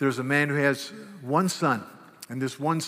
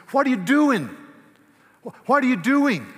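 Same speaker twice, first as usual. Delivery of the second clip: loud, much higher challenge; strong exhortation; 9 semitones higher, 7 dB louder than usual. Shown as a demonstration. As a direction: confront the brother head-on. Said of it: "come and say".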